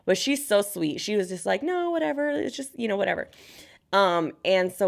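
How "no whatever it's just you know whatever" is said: higher, breezy mock friend voice; easygoing best friend. Best friend brushing off the cost, higher breezy voice. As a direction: shrug it off lightly in a higher voice.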